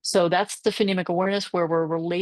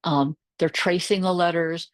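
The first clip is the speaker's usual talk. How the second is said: normal talking voice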